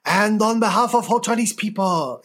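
put-on podcast host does a Mao accent for the letter; mock Mao accent. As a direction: read it as Mao would, hammed-up. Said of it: "Should I do this one in a Mao accent?".

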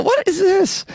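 high disbelieving squeal; incredulous squeak. Real, not acted. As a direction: shriek it in disbelief. Mocking online burial.

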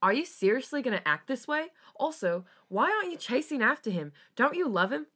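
high, loud incredulous challenge; indignant disbelief. A narrator's reading. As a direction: confront him, pitch climbing. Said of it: "Felix scoffed in disbelief".

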